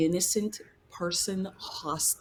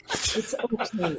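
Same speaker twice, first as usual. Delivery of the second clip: therapist voice; 4 semitones higher, slower than usual. Acted. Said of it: "I'm trying my therapist voice again".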